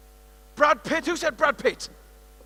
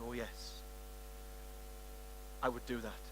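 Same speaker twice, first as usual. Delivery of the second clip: quiet; 11 semitones lower, 22 dB quieter than usual. Retold or imitated. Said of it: "She said".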